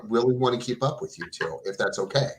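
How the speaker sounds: ordinary talk, nothing marked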